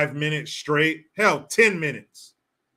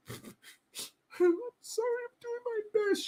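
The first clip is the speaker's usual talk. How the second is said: mock crying voice; 9 dB quieter than usual. Imitating AOC crying.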